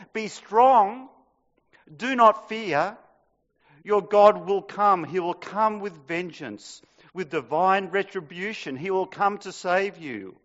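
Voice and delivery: loud, ringing prophetic reading; proclaiming scripture. Acted. Preacher proclaiming Isaiah to the congregation, ringing. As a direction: proclaim the promise boldly.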